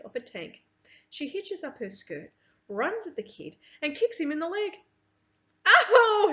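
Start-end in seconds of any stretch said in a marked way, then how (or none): 5.7-6.3 sharp loud yelp of pain; pained scream "ow". A narrator's reading. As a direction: scream as if kicked in the leg. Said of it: "he screams".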